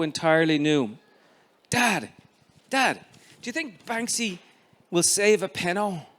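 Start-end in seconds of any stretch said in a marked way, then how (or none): 1.7-6.1 put-on high, eager boy's voice; eager child. Boy pestering his father about the match, high and eager. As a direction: keep tugging at him, bursting to know.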